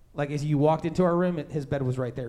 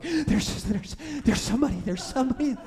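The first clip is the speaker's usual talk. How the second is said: stammering, breathless, higher voice; retold stammering fear; 7 semitones higher than usual. Terrified teen telling his parents someone is there, stammering. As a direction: stumble over the words, too scared to talk. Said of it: "I can barely talk".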